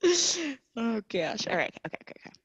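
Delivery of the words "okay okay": usual speaking voice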